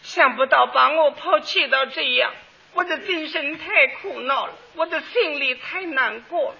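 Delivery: mock an old-school actress weeping; hammed-up stage grief; stagey actress crying.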